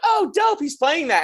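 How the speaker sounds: high, bright-eyed "oh, dope"; pleased surprise. Retold or imitated. Guitarist spotting a flyer online, happily surprised. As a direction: pleasantly caught off guard. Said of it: "and I'm like".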